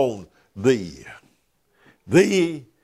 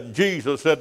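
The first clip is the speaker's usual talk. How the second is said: usual speaking voice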